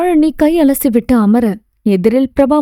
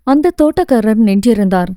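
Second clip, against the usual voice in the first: usual speaking voice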